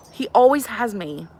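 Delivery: ordinary talk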